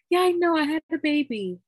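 high, loud, giddy announcement; high, loopy self. Retold or imitated. Tells her dad the news while high, loud and giddy. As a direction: blurt it out like you're tipsy. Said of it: "I'm so high".

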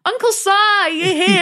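put-on high, bright sing-song welcome from the kids; cheery family greeting. Family bursting in, greeting uncle with a high excited squeal. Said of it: "the family run in and they're like".

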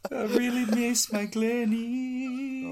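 high and slow, lovesick; teasing the others with a syrupy high voice; sappy mock voice. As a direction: croon it like a soppy sweetheart. Mocking the sappy video voice.

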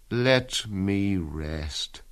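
very slow, fading to near silence; fading resignation. Acted. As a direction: let the life drain out. Dying soldier giving up.

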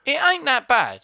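squeaky high goofy voice; high silly voice. Pretend. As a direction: pitch way up like a cartoon. Defending the sequel, voiced.

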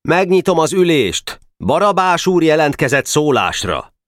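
put-on high, formal boyish chairman; official voice. A narrator's reading. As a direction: open the meeting officiously. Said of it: "hivatalos hangon szólt".

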